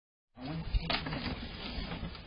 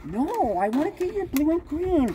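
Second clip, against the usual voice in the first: whiny; 11 semitones higher than usual. Real, not acted. Trespasser insisting on the cans.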